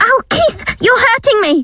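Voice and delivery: high, pained cry of hurt. Acted. Gail cries out as Keith hurts her, high and pained.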